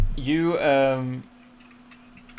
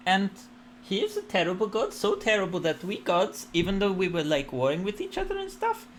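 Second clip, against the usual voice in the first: higher, solemn goddess voice; serene goddess storytelling; 6 semitones higher than usual. Acted. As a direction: recount ancient evil gravely. Goddess tells old legend.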